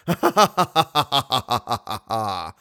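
menacing villain laugh; terrible laugh. Acted. Eric laughing at Christine after his threat, menacing. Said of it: "the ventriloquist's terrible voice".

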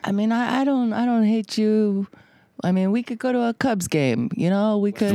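lightly voiced Mexican accent. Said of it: "That was me doing a Mexican accent".

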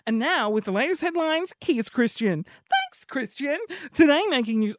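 mock newsreader intro. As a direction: hand over the bulletin like a radio anchor. Demo of newsreader dream.

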